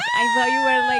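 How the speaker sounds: shrieking high and loud; hammed-up high shriek of a commenter; squealed quote. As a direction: squeal the quote in mock disbelief. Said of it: "they said".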